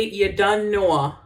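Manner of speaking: slow, careful Jamaican patois demo; slow Jamaican patois demonstration; Jamaican patois phrase. Shown as a demonstration. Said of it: "if I say".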